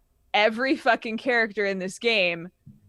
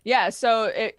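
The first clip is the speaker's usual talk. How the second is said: normal talking voice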